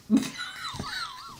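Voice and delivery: high screeching burst of laughter; shrieking laugh. Real, not acted. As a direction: let out a piercing shriek of a laugh. Burst of laughter over covid.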